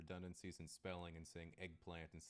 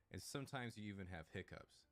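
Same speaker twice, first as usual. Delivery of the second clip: normal talking voice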